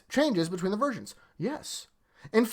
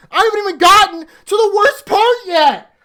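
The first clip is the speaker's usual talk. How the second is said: much higher and louder, venting; loud outraged rant; 11 semitones higher, 9 dB louder than usual. Real, not acted. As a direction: shout it out in disbelief. Rising outrage while ranting.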